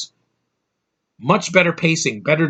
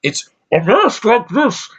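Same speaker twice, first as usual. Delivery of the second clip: high-pitched, slow masked villain voice; Bane; 7 semitones higher, 3 dB louder, slower than usual. Acted. Reviewer mocking the actor's masked villain, laughable impression. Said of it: "his Bane voice is laughable".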